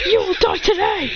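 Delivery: high shouted threat. Acted. Fighter threatening the Saiyan, high shout. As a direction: roar the death threat. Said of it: "When he says that".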